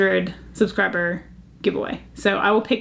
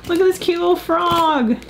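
high, gushing over a cute frog; delighted gasp; 8 semitones higher than usual. Real, not acted. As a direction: coo at the little frog.

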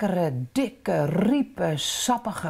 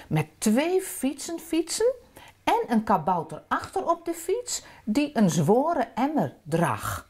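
ordinary delivery

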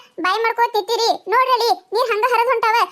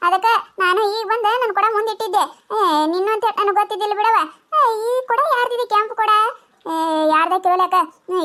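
ordinary talk, nothing marked

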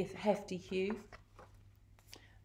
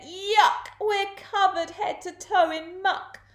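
high, loud storybook revulsion; disgusted outcry; 12 semitones higher, 4 dB louder than usual. A narrator's reading. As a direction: cry out in high-pitched disgust. Crook buried in muck.

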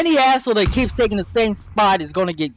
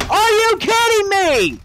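exasperated outburst; 9 semitones higher than usual. Real, not acted. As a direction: yell it at the screen in frustration. Raging at an online shooter.

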